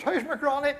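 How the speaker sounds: high, distressed woman's voice; sick wife scolding her husband, high and upset. Acted. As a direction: wail it like a wife in a hospital bed. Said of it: "She says".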